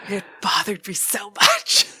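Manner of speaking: loud, hammed-up wail of annoyance; comically aggrieved mock cry; mock anguish. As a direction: play up the torment, loudly. Confessing an exaggerated annoyance.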